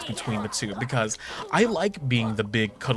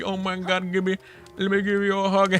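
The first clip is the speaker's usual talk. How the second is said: imitating auntie; 8 semitones higher than usual. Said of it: "And then my auntie".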